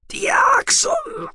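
a very high, strained outcry; desperate cry. A narrator's reading. The dying noble crying a name in anguish. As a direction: cry the name out with your last breath.

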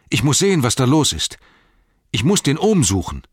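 high, loud and urgent, Sam beside himself; urgent outcry. A narrator's reading. Sam to Frodo, desperate to find his gaffer, loud and high. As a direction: call it out in alarm, voice up and loud. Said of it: "rief er".

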